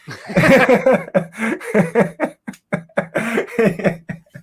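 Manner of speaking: high burst of laughter; laughing. Real, not acted. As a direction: laugh at your own bit. Laughing after the impression.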